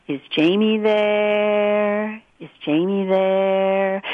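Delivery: very slow, monotone otherworldly caller; imitating a mysterious phone voice, stretched out; eerie man's voice, prolonged. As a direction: draw out the last word on one held note.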